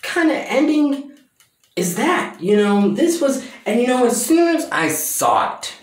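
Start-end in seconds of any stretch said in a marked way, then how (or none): 0.0-2.4 high, incredulous outcry; mock disbelief. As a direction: sound baffled and outraged. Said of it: "I was like".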